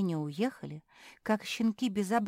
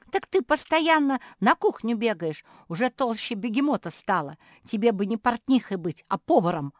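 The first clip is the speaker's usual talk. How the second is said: loud, higher taunting tirade; heated shouting; 6 semitones higher, 7 dB louder than usual. A narrator's reading. Жози taunting her sister mid-fight, shouting excitedly. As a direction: hurl insults in the thick of a fight. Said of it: "в азарте драки закричала Жози".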